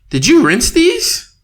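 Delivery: a hint of a nagging partner; imitating partner. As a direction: mimic her leading question.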